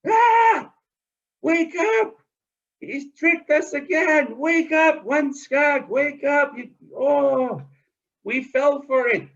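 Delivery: high, loud frantic call; panicked alarm. Acted. Mud hen shrieking to wake the knocked-out ducks, high and frantic. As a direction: shriek to wake them all.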